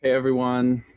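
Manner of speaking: ordinary talk, nothing marked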